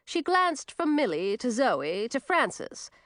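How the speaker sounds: ordinary delivery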